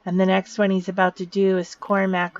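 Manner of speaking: ordinary talk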